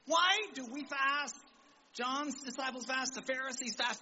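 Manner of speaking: high-pitched, drawn-out questioning; mock a puzzled complaint, pitched well up; acted challenge. Acts out questioners challenging Jesus.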